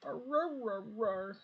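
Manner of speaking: high, slow mock dog chorus; high hammed-up barking dog voice. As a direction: howl it like a row of huskies. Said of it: "being like".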